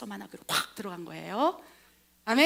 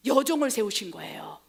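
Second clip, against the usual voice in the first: ordinary delivery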